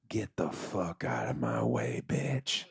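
low, slow growl of impatience; gruff dismissal. Acted. Storyteller as starving self brushing past Hisham, gruff dismissal. Acts himself shoving past his friend.

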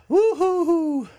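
high, slow, drawn-out woo-hoo-hoo; mock-triumphant high hoot; silly whoop. Real, not acted. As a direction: whoop it out like a goofy cheer.

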